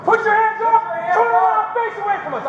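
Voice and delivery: shouted surrender demands. Real, not acted. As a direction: yell the orders at the suspect. Police ordering suspect out.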